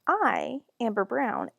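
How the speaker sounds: usual speaking voice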